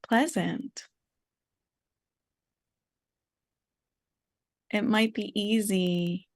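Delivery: unhurried meditation guide, long pauses; guided meditation. Real, not acted. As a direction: gently invite listeners to notice, leaving space.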